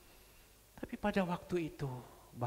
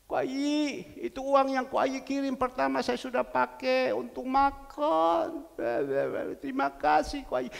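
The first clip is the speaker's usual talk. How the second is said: a hint of the widow's teary thanks, higher; tearful widow; 6 semitones higher, 4 dB louder than usual. Widow thanks the pastor on the phone, lightly tearful.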